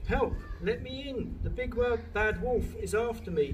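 high-pitched little pig voice; panicked squeal. A narrator's reading. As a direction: squeal for help as if being chased. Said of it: "he squealed".

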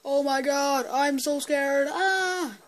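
high, loud mock terror with cries; hammed-up fright. Kid pretending to be scared of his own effect, hammed-up shrieks. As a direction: play at being terrified, squealing.

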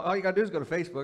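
ordinary delivery

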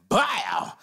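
punchy, high "bow" noise; mouthed sound effect. Acted. Suspect adds noises to the sobriety test, mouthed sound effect. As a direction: make a cartoonish impact noise with your mouth. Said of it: "gotta make the sound effect".

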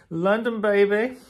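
high, loud London cheer; excited. Real, not acted. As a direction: shout it out with glee. Excited exclamation about trip.

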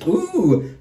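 a high, intrigued ooh; ooh of intrigue. Real, not acted. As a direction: react as the card is revealed. Drawing a tarot card.

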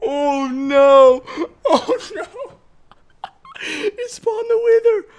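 high, wailing oh no; dread. Real, not acted. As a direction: let the dread rise up high. Wither spawns, dread.

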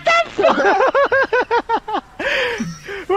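ordinary talk

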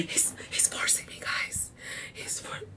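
mock hostage whisper to the audience. Streamer whispering to viewers he's being forced. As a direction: whisper it like a captive asking for help.

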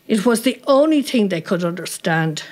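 usual speaking voice

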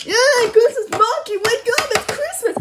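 loud, high playful shouting; excited wake-up call. Pretend. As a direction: rouse a sleeping friend with pretend glee. Waking Rocky on Christmas morning.

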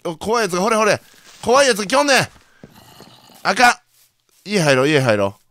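loud panicked shout at the approaching monster; panicked yelling. Real, not acted. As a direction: yell as the monster closes in.

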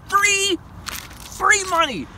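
drawn out. Acted. Hyping free money picks.

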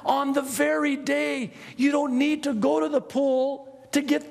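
high, half-sung proclamation; Jesus shouting in the temple, high and loud. Pretend. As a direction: call out the promise over the crowd. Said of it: "What's he shouting in a loud voice".

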